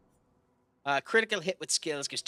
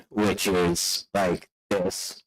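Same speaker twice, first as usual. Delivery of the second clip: loud, lower, very slow; Trash Mike voice; 5 semitones lower, 7 dB louder, much slower than usual. Shown as a demonstration. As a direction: drag it out low and loud. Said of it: "Trash Mike".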